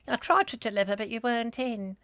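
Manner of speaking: a hint of a sing-song official; mock delivery card.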